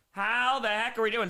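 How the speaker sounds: usual speaking voice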